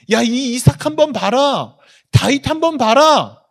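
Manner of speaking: God's proud boast, building to a loud, high shout. Acted. God showing off Abraham's faith to all, proud boasting. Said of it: "하나님이 자랑하고 싶어서".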